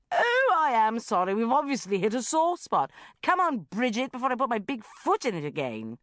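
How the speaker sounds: loud, high, scathing apology; mock apology. A narrator's reading. Sharon leaving the red-faced banker, mock-apologetic. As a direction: apologise with heavy sarcasm.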